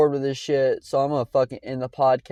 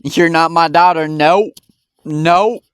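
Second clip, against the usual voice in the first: imitating a mother brushing off her daughter, louder and higher; mock dismissive mother; 4 semitones higher, 5 dB louder, slower than usual. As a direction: brush her off with a flat nope, nope. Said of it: "She's like".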